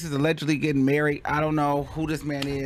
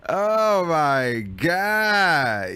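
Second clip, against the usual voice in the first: amused exasperation; 4 dB louder, much slower than usual. Real, not acted. Commentator recovering from a laughing fit, drawn out. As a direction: stretch it out, spent from laughing.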